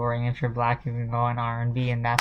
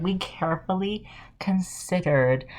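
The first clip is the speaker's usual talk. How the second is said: high, soft prissy mock voice; mocking Grammys; 5 semitones higher, 5 dB quieter than usual. Mocking the Grammys' official statement, high and prim. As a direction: send up their pompous statement. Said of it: "They're like".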